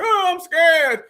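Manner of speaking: mocking "scared" cry. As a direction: wail it slowly, making fun of the frightened. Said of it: "everybody's scared".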